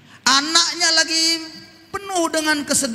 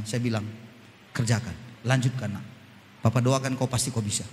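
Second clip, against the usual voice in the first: tender encouragement; 12 semitones lower, 4 dB quieter than usual. Retold or imitated. Father comforting his crying daughter, soft and low. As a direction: hush the words like a father holding his crying child.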